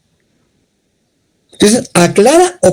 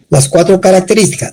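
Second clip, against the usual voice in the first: ordinary talk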